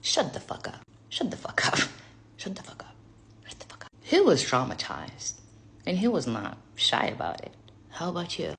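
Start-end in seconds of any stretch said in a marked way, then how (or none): 0.0-3.9 whispered disbelief. Acted. Repeated, fading whispered disbelief.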